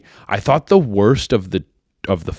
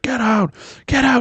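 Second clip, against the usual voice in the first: urgent. Retold or imitated. Imagined crew after crash.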